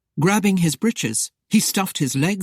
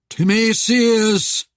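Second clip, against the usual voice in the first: slow, loud, drawn-out spectral summons; droning call; 6 semitones higher, 6 dB louder, much slower than usual. A narrator's reading. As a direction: stretch the name into a raised, haunting drone. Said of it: "the droning voice called".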